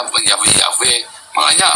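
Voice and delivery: ordinary talk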